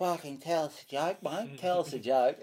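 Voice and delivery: heckler. Retold or imitated. Hecklers' demand acted out.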